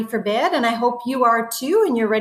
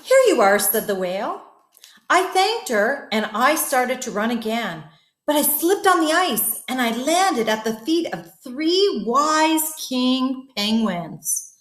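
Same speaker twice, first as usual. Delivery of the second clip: story narration. A narrator's reading. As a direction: tell it like a bedtime story. Reads story to children.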